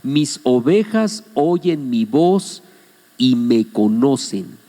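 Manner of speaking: slow, deliberate scripture quote; measured and emphatic quoting; very clear. Retold or imitated. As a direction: spell it out slowly, phrase by phrase. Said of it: "el Señor dice de manera muy clara".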